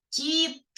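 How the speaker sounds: "кіт" with a detached final т; last sound picked out. Shown as a demonstration. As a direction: split off the т at the end of "кіт". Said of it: "останній".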